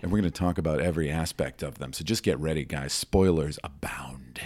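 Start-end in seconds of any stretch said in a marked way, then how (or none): 2.9-4.4 slow whispered trailer tagline; movie trailer voice. Acted. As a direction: breathe it like a horror trailer tag. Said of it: "movie trailer voice".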